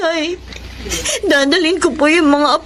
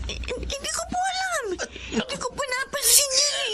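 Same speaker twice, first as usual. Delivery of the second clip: frightened; 8 semitones higher, 12 dB quieter than usual. Acted. Panicked mother, sick baby.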